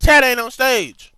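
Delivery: high, loud and drawn out; loud, slow, exasperated retort; emphatic rebuttal. Real, not acted. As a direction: holler it slowly to drive the point home. Rebutting the take-the-joke excuse.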